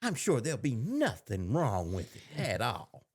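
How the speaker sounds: ordinary delivery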